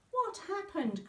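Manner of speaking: high, startled question; alarmed cry. A narrator's reading. Mother sees her daughter barking, alarmed cry. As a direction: gasp it out in shock. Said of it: "cried her mother".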